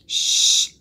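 loud, sharp hushing "shh"; whispered shush. A narrator's reading. Jack hushes his sister, forceful whispered shush. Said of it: "whispered Jack".

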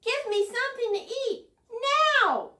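high, loud bossy demand; shouting at Rabbit for food. Acted. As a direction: insist on food right now. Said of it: "shouted Bird".

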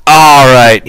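curt, clipped-off order; brusque. A narrator's reading. George cutting Lenny off, brusque. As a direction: cut him short, no patience. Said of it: "he said brusquely".

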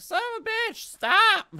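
high, strained cry to make him stop; retold yell at a villain, pitched high; exasperated yell. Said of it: "I was like".

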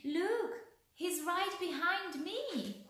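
high, loud cry of alarm; shrieking. A narrator's reading. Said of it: "shrieked the little girl".

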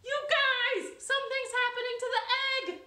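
very high, loud, urgent cry; alarmed excitement. Acted. Jessica watching the egg crack, urgent and loud. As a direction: call the friends over fast. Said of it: "And Jessica said".

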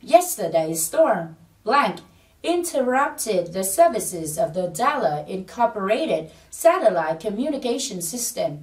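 slow, measured read-aloud of an English sentence; model sentence for repeating. Shown as a demonstration. Teacher leading students in repeating the test sentence, slow model reading. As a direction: read it slowly, word by word, for learners. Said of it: "chúng ta cùng nói lại một lần nữa là".

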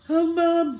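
put-on high, pitiful sick-child whine; feigned weakness. Boy angling for treats from mum, feigning weakness. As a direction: play up being poorly. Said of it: "trying to sound as weak as possible".

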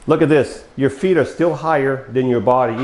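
normal talking voice

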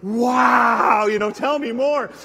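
loud sarcastic "wow"; mock-cry of amazement; mock enthusiasm. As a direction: ham up fake fascination.

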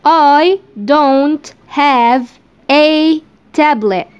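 I don't have a tablet drawn out word by word, very slow; slow dictation. Shown as a demonstration. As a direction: dictate each word slowly for learners. (نطق بطيء جدًا كلمة كلمة)

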